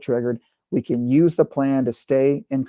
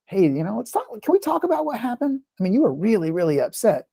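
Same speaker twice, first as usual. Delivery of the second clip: gentle invitation; 8 semitones higher than usual. Retold or imitated. Mother inviting daughter to talk days later, gentle and coaxing.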